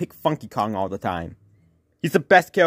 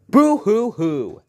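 mock crying; 5 semitones higher, 4 dB louder, slower than usual. Mocking the sore loser.

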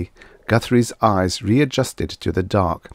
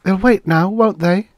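put-on high, louder girl asking; girl's voice; 12 semitones higher, 5 dB louder than usual. A narrator's reading. Karen seeks reassurance from Guthrie, in a high girl's voice. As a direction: ask in a raised female character voice. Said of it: "she asked".

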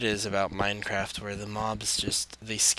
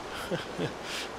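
nervous laugh; 7 semitones higher, 7 dB quieter than usual. Real, not acted. Laughs after panicking.

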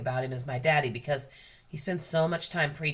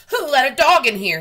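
shouting manager, much louder and higher; angry shout; 10 semitones higher, 14 dB louder than usual. A narrator's reading. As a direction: yell it at the whole store, furious. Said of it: "he kept shouting".

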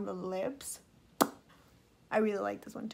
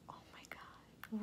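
awed whisper; 18 dB quieter than usual. Real, not acted. Whispers in awe at the color.

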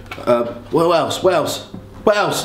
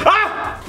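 yelp. Acted. Burned hand on hot pan.

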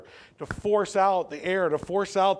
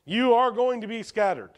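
higher, emphatic declaration; confident; 5 semitones higher than usual. Retold or imitated. Preacher restates Jesus' warning to the disciples, confident. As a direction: pronounce it as a certainty, pitched up. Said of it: "does that sound like maybe or confident".

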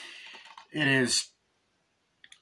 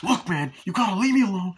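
louder, high-pitched acted protest; exasperated refusal; 9 semitones higher, 6 dB louder than usual. Friend wants hero to leave him alone, exasperated imitation. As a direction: snap it out, imitating a fed-up man.

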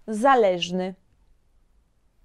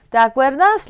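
ordinary talk, nothing marked

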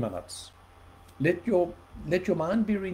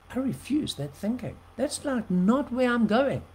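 defiant refusal; 8 semitones higher than usual. Retold or imitated. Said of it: "I went like".

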